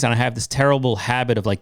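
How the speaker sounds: ordinary talk, nothing marked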